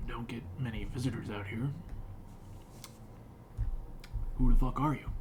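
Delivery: a hint of a gruff hermit. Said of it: "in a gruff voice".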